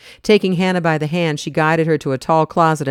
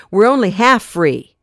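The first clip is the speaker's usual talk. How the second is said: rising held-back fury, higher and louder; suppressed anger; 6 semitones higher, 6 dB louder than usual. Acted. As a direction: let the anger strain against control. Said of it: "voice quivered with suppressed anger".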